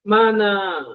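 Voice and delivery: "Mana" said slowly and clearly, pitch falling; Quechua word demo. Shown as a demonstration. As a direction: model "Mana" with a falling tune. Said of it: "Son sí es negativo".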